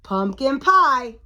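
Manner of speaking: ordinary delivery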